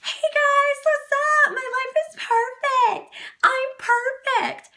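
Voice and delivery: high, loud mock-cheery greeting; fake perfect vlogger. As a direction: parody a perfect-life vlogger at full volume. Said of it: "I'm not here to be".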